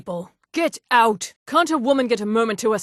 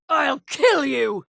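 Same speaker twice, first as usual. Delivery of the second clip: ordinary talk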